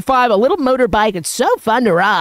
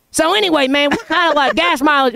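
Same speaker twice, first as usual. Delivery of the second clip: a hint of a higher Southern twang; lightly voiced Southern neighbor; 5 semitones higher than usual. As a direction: casually switch topics like the rural neighbor. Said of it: "and he goes".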